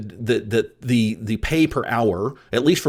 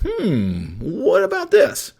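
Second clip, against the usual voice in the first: slow, drawn-out doubting hmm, then higher; a lightly voiced suspicious inner thought; skeptical hmm; 6 semitones higher, much slower than usual. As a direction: mull it over like a hardened skeptic. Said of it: "I look at everything through the lens of".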